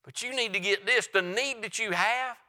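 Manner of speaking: loud, driving pulpit delivery; full-force revival sermon voice; fervent preaching. Acted. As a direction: preach it hard to the congregation.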